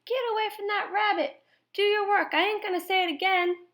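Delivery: put-on high, shrieking old woman's scolding; angry old woman. A narrator's reading. Old woman yelling at the boy to work, high. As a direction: yell it like an exasperated old woman.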